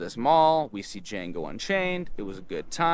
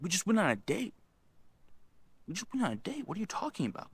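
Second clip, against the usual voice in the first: high, hushed disbelief; mock-baffled, pitched up and softer; bewildered protest; 8 semitones higher, 6 dB quieter, faster than usual. Rejected teen answering her breakup message, bewildered. I had no idea.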